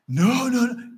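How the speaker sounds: high, loud string of noes; alarmed protest. Acted. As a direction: cut in with a high, insistent denial. Peter protests Jesus's prediction.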